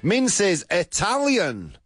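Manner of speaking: high, slow game-show announcement; excited reveal. Real, not acted. Host reveals Min's top answer, excited reveal. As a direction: build the big reveal, pitched high.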